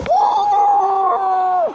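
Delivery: sudden high, loud shout of joy; a real burst of a yell at the crossbar. Real, not acted. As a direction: yell out at the goal. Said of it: "bağırdım çünkü".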